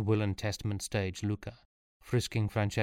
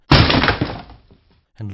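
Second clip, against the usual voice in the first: loud, very slow, emphatic; dramatic; 14 dB louder than usual. A narrator's reading. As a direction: draw it out loudly. Dramatic narration.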